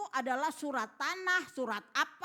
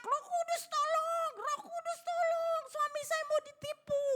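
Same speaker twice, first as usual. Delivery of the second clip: imitated screaming. Wife screaming to the Holy Spirit for help, imitated screaming. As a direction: scream it out high, stretching the words like the wife. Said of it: "Terus dia teriak-teriak".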